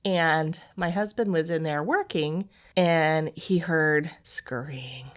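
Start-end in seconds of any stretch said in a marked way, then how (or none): 4.2-5.1 whisper. Retold or imitated. Spooky retelling of noises.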